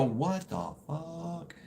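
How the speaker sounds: soft, high, drawn-out amazement; imagined disbelief. Retold or imitated. Future listeners hearing the story, mock stunned disbelief. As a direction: let it out slowly, hushed and stunned. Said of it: "people are going to go".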